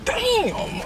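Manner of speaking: shocked high "damn" at the price; surprised. Real, not acted. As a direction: react with real shock to the price. Reacting to the price.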